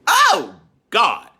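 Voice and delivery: normal talking voice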